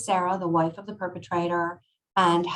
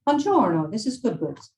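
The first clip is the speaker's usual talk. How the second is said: put-on higher, lilting Italian-accented phone greeting; butchered Italian accent; 4 semitones higher, 4 dB louder than usual. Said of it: "I'm going to butcher an Italian accent".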